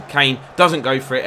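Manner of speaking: usual speaking voice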